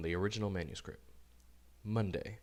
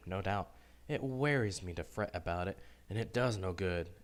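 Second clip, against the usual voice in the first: ordinary delivery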